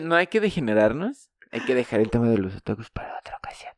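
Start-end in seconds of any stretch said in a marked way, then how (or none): 2.4-3.7 susurrando en secreto; susurro. Pretend. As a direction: baja la voz a un susurro. Se susurran sobre otakus. (susurro cómplice)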